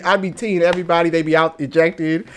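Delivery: normal talking voice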